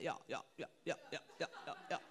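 low, soft mock-refined chatter; imitating "yah yah" friends. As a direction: mimic friends murmuring yah in agreement. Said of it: "I call them Yah Yah friends 'cause they're like".

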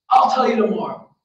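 acted, emphatic evasive reply; son's brush-off. A narrator's reading. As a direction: toss it back loudly at the parents. Son brushing off parents in story.

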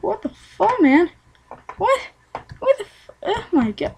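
high squeaky what the; mock disbelief. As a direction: squeak it in shock. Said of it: "I was like".

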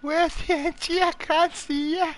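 mock a panicked teammate up high; high squeaky panicked imitation. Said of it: "He's like".

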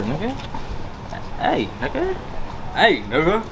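ordinary talk, nothing marked

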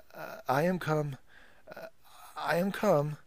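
high, hesitant stammer; faltering. Acted. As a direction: stumble over the words. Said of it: "faltered Wang Lung".

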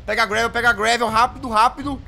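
fast, loud hurried urging; frantic rushing. Real, not acted. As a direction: rush it, out of time. Rushing to grab gravel.

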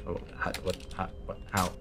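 low, very soft mumbling; baffled muttering. Real, not acted. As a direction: trail through broken words under the breath. Confused muttering to self.

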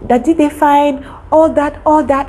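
sweet, respectful daughter-like tone; answering an elder politely; polite reply. Retold or imitated. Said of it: "She was like".

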